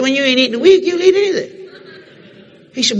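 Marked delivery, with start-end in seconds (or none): 0.0-1.5 high, loud pleading of a starving man; preacher pleading he's starved for days. Retold or imitated. To the gas station man, a high pleading voice. Preacher voicing his hungry self.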